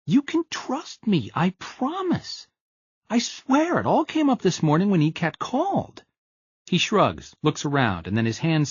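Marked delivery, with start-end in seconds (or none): 0.1-6.0 higher, slow, earnest pleading friend; pleading Gus. A narrator's reading. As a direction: beg him to believe you, drawn out. Gus pleads his innocence.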